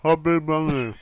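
"Chubby bunny" mumbled through a full mouth; mouth stuffed full. Real, not acted. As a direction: say "Chubby bunny" with seven chocolates in. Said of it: "I might feel sick".

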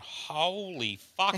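drawn-out stunned "holy fuck"; slow shocked drawl. Retold or imitated. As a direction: stretch it out in disbelief. Batsman shocked at naked bowler.

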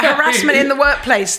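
high, loud, laughing protest; laughing mock outrage. Real, not acted. Guest laughing at the host hugging the dog. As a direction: cry foul through laughter.

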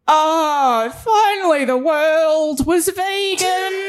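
slow, high wailing cry of a dying whale. Acted. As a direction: wail it out like a whale in its last agony. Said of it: "so the dying whale was a bit like".

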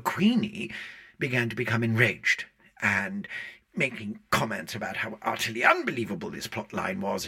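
ordinary delivery

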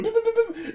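high, soft mimicked keypad beeps; phone beeps. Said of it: "He went".

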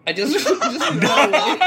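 loud, high, cracking up mid-sentence; laughing confession. Real, not acted. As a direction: burst out laughing while admitting it.

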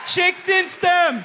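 loud slow rallying shout; loud drawn-out cheer. Real, not acted. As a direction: whoop it to the crowd.

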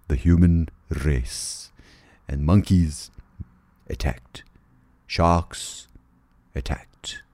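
low, slow movie-trailer narrator; solemn doom-laden storyteller with weighty pauses; mock-epic narration. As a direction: intone it like an apocalyptic saga.

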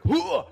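loud, high heaving exclamation; vocal heave of hoisting yourself up; effort grunt. Acted. Player's character pushing up onto the stage, loud effort cry.

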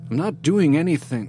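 high, loud denial; alarmed protest of innocence; panicked protest. A narrator's reading. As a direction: protest it, voice jumping up.

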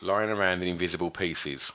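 usual speaking voice

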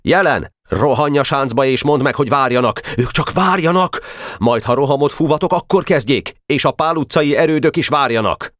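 high, pressing military instructions; urgent orders. A narrator's reading. Commander sending his adjutant to the forts, urgent orders.

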